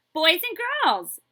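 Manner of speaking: put-on loud, high, slow kids' shout; happy shout. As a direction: cheer the answer out together. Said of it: "the kids shouted happily".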